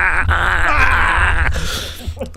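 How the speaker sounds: long, drawn-out pretend attack roar; mock monster scream. Host pretending to attack through the computer, monster scream. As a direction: let out a hammed-up creature screech.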